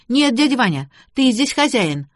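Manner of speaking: lightly voiced contrary niece; disagreeing girl. A narrator's reading. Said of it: "не согласилась Шарлотта".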